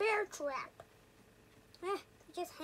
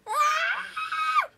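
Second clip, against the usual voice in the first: startled scream; 13 semitones higher, 6 dB louder than usual. Real, not acted. Startled by neighbor.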